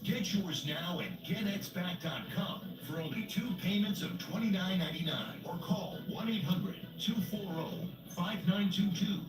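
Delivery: hard sell ad. Acted. Announcer ad read.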